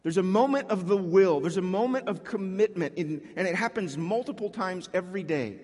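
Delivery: ordinary talk, nothing marked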